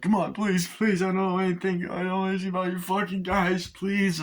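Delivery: high, pleading cop voice; imitated desperate begging. The hostage cop begging his torturer, high and desperate. Cop begs, says he knows nothing.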